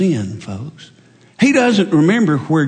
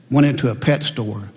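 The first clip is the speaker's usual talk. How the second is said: normal talking voice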